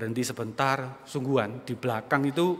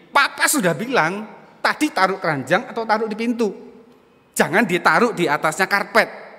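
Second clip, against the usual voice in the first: raised-voice reenactment of a father's scolding; retold scolding; 6 semitones higher, 6 dB louder than usual. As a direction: scold loudly, higher, like a retold dad's outburst. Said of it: "nadanya tinggi".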